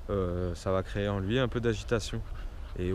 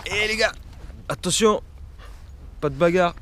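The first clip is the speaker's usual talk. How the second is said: calling out; 7 semitones higher than usual. Real, not acted. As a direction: call out firmly to break it up. Breaking up dog scuffle.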